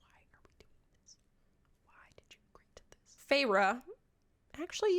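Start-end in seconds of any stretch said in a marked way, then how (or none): 0.1-3.2 whisper. Retold or imitated. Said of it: "he's very much like".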